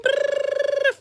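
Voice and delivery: high rolled "brrr"; celebratory trill. Real, not acted. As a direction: trill it high like a cheering fanfare. Drum roll cheer for front liner.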